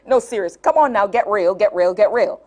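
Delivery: ordinary delivery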